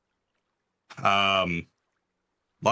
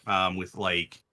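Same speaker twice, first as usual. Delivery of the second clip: ordinary talk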